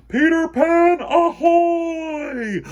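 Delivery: high, loud call from the crow's nest; lookout's shout. Acted. Lookout hails the captain below, shouting. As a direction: bellow it down to the deck.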